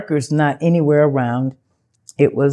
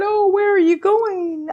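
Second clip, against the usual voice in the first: people asking; 14 semitones higher than usual. Retold or imitated. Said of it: "And the people said".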